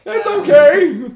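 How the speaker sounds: high, slow, loud ghost; a fretful ghost soothing; nervous ghost. Acted. Ghost voice in scene.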